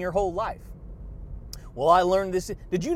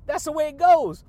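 louder and much higher, falling at the end; belted catchphrase; 6 semitones higher, 8 dB louder than usual. Real, not acted. Vlogger belting his catchphrase to viewers. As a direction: belt it like a sign-off.

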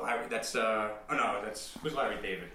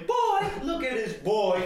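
high, musical, louder toward the end; Cameron impression; 9 semitones higher, 4 dB louder than usual. Comic answering the list reader, high chanted impression.

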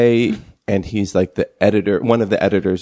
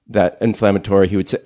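ordinary talk, nothing marked

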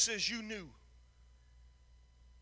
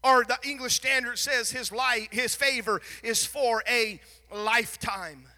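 loud, rising, fervent preacher cadence; full-force preaching; 4 semitones higher, 9 dB louder than usual. Acted. Preacher to his church on God's mercy, in a full-force preaching voice. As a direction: drive each line home to the congregation, louder.

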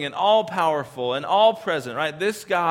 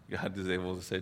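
ordinary talk, nothing marked

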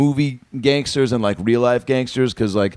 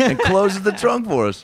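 laughing; 9 semitones higher than usual. Real, not acted. Laughing while finishing the story.

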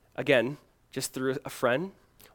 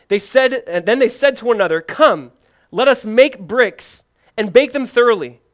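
raised, higher preaching voice; scripture reading; 6 semitones higher, 8 dB louder than usual. Acted. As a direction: proclaim the passage loud and high. Reads scripture aloud with force.

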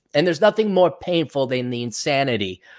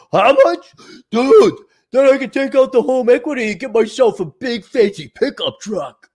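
high, louder put-on dude voice, slowing at the end; eager dumb borrower; 9 semitones higher, 4 dB louder than usual. Loan-hungry borrower to banker, high put-on dude voice. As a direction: play a greedy borrower dreaming of a truck.

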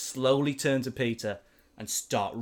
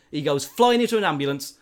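ordinary talk, nothing marked

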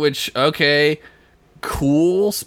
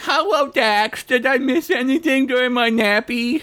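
put-on sleepy child; 11 semitones higher than usual. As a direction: yawn it out like a little boy. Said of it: "sleepy boy".

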